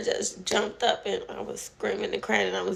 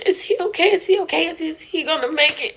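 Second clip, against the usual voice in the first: hoog en luid, paniekerig herhaald; retold screaming; 7 semitones higher, 6 dB louder, faster than usual. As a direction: roep het angstig uit, steeds opnieuw. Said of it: "I keep screaming".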